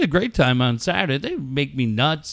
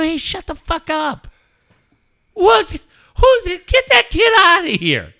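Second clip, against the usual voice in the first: high, loud mock yelling at a stranger's kid; hammed-up outburst, high and loud; 5 dB louder than usual. Dad yelling at a screaming kid in Target, mock yell. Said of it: "And I go".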